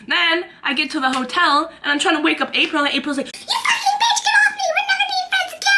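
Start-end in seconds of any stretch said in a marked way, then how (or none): 3.3-5.7 much higher, laughing mock screech; mock screech of a friend. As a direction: shriek like an angry drunk friend. Drunk friend April yelling, laughing.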